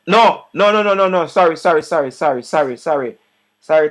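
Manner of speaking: flustered on-air apology; flustered correction. Real, not acted. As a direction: catch your own slip, flustered. Frantic self-correction on air.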